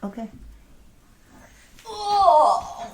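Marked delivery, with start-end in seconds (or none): 1.9-2.9 high, loud laughing oh; laughing exclamation. Real, not acted. Amused by her squirming dog, laughing high exclamation. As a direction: burst out laughing on the oh.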